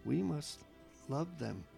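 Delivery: normal talking voice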